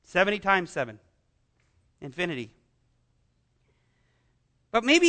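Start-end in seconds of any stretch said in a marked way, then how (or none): 0.0-2.5 hushed answer. Real, not acted. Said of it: "quiet dramatic answer".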